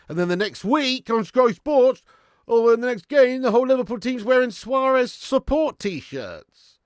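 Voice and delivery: loud, high, worked-up rant; angry rant. Real, not acted. Fan fuming loudly at the rival team's shirts, angry rant. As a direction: sound off about it, loud and high. Ranting about Suarez support shirts.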